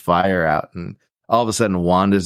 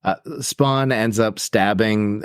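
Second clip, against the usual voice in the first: ordinary talk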